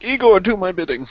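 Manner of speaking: lightly acted villainess giving an order; commanding mistress. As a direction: play the mistress ordering her minion about. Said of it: "where she's just like".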